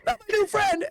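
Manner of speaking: a high, fearful little plea; high worried plea. Retold or imitated. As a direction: plead in fright for your friend. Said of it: "you're like".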